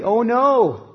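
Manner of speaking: high, loud alarmed exclamation; the servant's cry of fright at the enemy; cry of fear. Retold or imitated. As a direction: let fear burst out. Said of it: "It's a cry in the face of fear".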